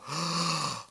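drawn audible gasp of wonder; mock awestruck intake of breath; amazed gasp. Shown as a demonstration. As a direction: gasp as if astonished. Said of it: "we all go".

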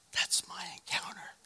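breathy whisper to himself; hushed whisper, deciding she's the one; whispered thought. Retold or imitated. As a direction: whisper it like a secret realization. Private thought while watching her.

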